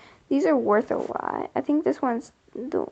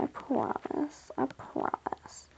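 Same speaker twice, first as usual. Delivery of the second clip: soft slow whisper; slow whispered promise to viewers; 11 dB quieter, slower than usual. Real, not acted. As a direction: hush it like a secret vow.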